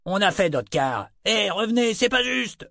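very high, whining protest; aggrieved wail; crying out. A narrator's reading. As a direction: call after her, voice climbing high. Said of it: "cria Arthur".

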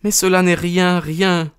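high-pitched distressed woman's voice; acted tearfulness, pitched high; tearful. A narrator's reading. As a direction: plead high, as if near sobbing. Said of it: "d'une voix entrecoupée de sanglots".

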